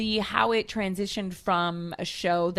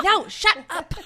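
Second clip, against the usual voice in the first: mock snap; 8 semitones higher, 6 dB louder, slower than usual. Mom snapping at child.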